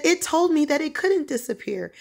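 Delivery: high, disbelieving retold report; high-pitched incredulity; astonished. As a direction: imitate him, amazed and still shaken. Acting out his astonished report.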